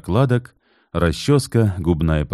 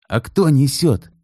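indignant; 9 semitones higher, 3 dB louder than usual. A narrator's reading. Indignant question at bank.

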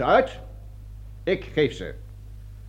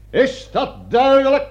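slow, loud and higher drill-sergeant bark; stern shout; 8 semitones higher, 6 dB louder, slower than usual. Acted. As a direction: hammer the question home to the recruits. Colonel barks at recruits. (luide, trage bevelstoon)

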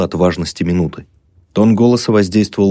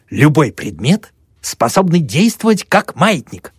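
pompous lecturer; 8 semitones higher than usual. Acted. Pyramidov explaining, voiced.

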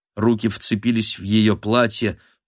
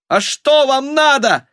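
very high, loud desperate question; cried out; 5 dB louder than usual. A narrator's reading. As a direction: shout it desperately at the crowd. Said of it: "выкрикнула Анна Васильевна". (отчаянный высокий выкрик)

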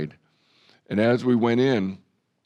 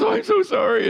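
high, louder playful wife voice; mock apologetic wife; 5 dB louder, faster than usual. Preacher imitating his wife Marcy's mock sorry, high and playful. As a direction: squeak out a teasing sorry. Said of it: "And Marcy goes".

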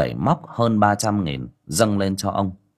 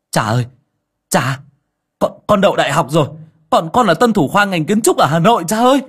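rising higher and louder, breathless with joy; joyful shout; 9 semitones higher, 7 dB louder than usual. A narrator's reading. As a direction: burst out the good news to his father. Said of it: "ôm chầm lấy bố mà gào lên". (gào lên vui sướng)

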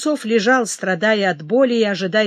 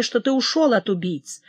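ordinary delivery

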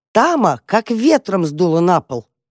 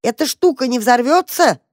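high, worried call over noise; shouting over bells; 7 semitones higher than usual. A narrator's reading. Mother asks mice about noisy box, shouting. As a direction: call out above the clanging. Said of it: "прокричала она сквозь колокола".

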